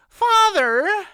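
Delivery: shrill, very high and loud protest; protesting daughter. A narrator's reading. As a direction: cry out at your father in protest. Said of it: "Anhelda protested".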